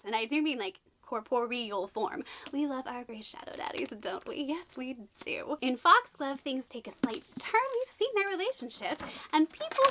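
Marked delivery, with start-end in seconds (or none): 2.5-5.6 softer teasing whisper. Real, not acted. Vlogger teases viewers about shadow daddies, whispering. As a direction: whisper it like a fangirl secret.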